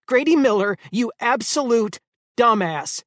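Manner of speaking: high, loud angry scolding; furious yell. A narrator's reading. Eleanor berating Grady after he nearly died, furious yell. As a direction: tear into him by full name.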